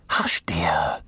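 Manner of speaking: low whispered shush; whispered hush. Acted. Ladies hushing the child.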